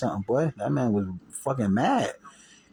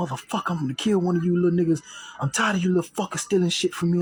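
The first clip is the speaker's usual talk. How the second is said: higher, fast mock threat from the gunman; angry gunman; 8 semitones higher, faster than usual. Gunman threatening the teen over stolen gear, fast higher imitation. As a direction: bark it like the man holding the shotgun. Armed man threatening him.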